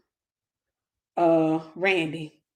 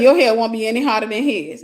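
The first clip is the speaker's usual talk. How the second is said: "Your hair won't be any hotter than his" louder, pressed; louder retelling; 2 semitones higher, 8 dB louder than usual. Retold or imitated. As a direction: say it again with more force. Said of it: "I said".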